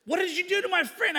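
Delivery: hammed-up indignation; mock accusing. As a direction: play an upset friend demanding answers. Ed's retold angry accusation.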